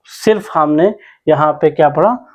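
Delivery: ordinary delivery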